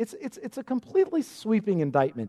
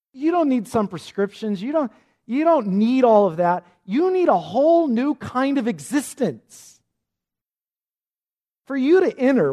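raised, driving, preacherly insistence; emphatic insistence; 6 semitones higher, 5 dB louder than usual. Acted. Jesus tells Nicodemus he needs new existence, emphatic and insistent. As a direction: push harder with each phrase, voice climbing.

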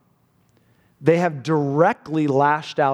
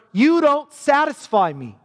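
raised, a hint of a cruel husband; cold husband; 8 semitones higher than usual. Preacher voicing the husband trashing his loyal wife, raised and cold. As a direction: hurl it at the wife with contempt. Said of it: "He says to her".

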